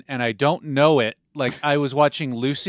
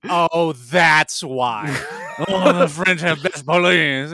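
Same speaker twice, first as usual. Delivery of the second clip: mock French realization; 4 dB louder, much slower than usual. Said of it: "it's like".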